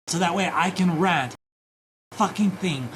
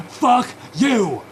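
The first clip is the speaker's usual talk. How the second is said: louder, higher furious curse; angry shout; 5 semitones higher, 5 dB louder than usual. Real, not acted. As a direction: spit it out at the screen. Yelling at Reddit on screen.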